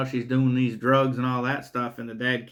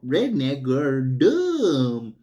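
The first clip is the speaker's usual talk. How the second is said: mocking sneer; much slower than usual. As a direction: sneer it out like a sarcastic villain. Killer mocking the redneck woman.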